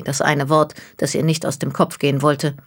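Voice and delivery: plain reading voice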